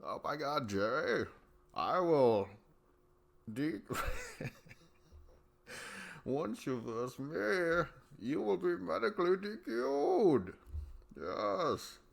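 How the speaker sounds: high, slow, haughty posh-Brit mock voice; uppity British Rogan. Mocking Rogan as an uppity Brit, high and drawn out. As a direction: play the offended upper-crust snob. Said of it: "if he was just like".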